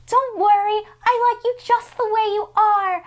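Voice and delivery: a breathy starlet reassurance; sweet Audrey. Retold or imitated.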